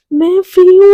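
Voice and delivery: loud, high, happy burst; excited cry. Retold or imitated. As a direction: blurt out your joy at being free. Radhika rejoices at freedom. (खुशी से ऊँची आवाज़ में)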